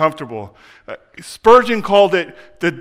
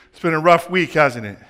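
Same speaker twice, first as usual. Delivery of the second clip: ordinary talk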